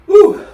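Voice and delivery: high loud woo from the burn; loud heat woo. Real, not acted. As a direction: shout through the spice. After another hot bite.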